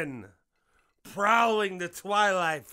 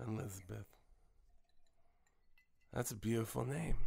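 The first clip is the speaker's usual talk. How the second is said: hushed; 11 semitones lower, 13 dB quieter, much faster than usual. Pretend. Savouring her name.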